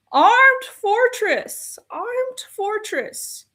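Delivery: slow, high, mocking stress on armed fortress; incredulous slow mockery; sarcastic emphasis. Real, not acted.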